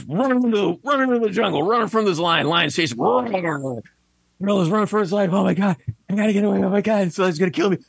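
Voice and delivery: high, hammed-up panic, speeding up; mock panic. Gorilla flees the lion through the jungle, hammed-up panic.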